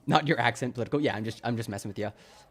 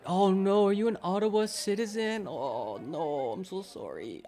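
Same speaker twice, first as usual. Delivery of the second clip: mock sympathy; 6 semitones higher than usual. Playful sympathy for an Ottawa resident.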